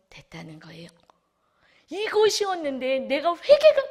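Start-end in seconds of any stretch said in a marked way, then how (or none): 1.9-3.8 much higher and louder; preaching burst. Real, not acted. Preacher urges the church, forceful. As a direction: push it out with force.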